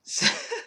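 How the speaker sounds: a real, helpless burst of laughter; sudden burst of laughter. Real, not acted. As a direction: break out laughing. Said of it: "I don't know why I'm laughing at".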